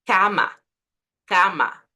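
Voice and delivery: "Cama" with open oral A's; Spanish open a. Shown as a demonstration. Teacher modelling Spanish "cama". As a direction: say "cama" with the mouth wide open. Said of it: "no decimos cama".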